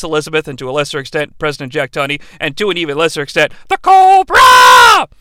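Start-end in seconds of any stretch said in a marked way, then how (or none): 3.7-5.1 slow, high, loud mock call; announcer shout. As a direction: draw it out like a ring announcer.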